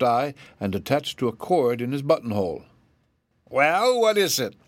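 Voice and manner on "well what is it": high, sharp little rabbit voice. A narrator's reading. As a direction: snap the question from behind bars. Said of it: "asked the rabbit sharply".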